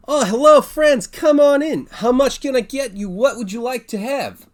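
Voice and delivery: high, loud, beaming innkeeper voice; cheerful bartender. Acted. Bartender waving the adventurers in, high and loud. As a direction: greet the party like an eager barkeep.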